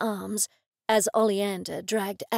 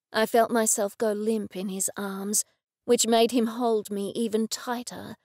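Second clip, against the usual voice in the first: ordinary delivery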